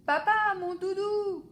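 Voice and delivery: high loud wail for the cuddly toy; boy shouting for his doudou; child shouting. A narrator's reading. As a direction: cry out for the doudou. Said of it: "crie Alphonse".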